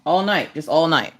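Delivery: ordinary talk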